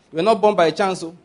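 ordinary talk, nothing marked

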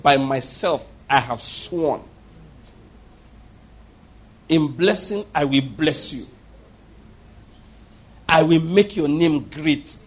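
slow, measured divine oath; solemn voice of God. Acted. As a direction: pronounce each promise slowly and gravely. God's oath, voiced slowly.

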